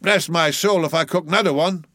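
high indignant grumble; angry growl. A narrator's reading. Old cook turning to leave, angry grumble. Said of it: "he growled".

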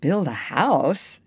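slow, drawn-out disbelief; incredulous exclamation. A narrator's reading. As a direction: question it in amazement. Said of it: "exclaimed John".

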